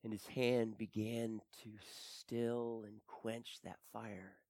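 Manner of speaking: ordinary talk, nothing marked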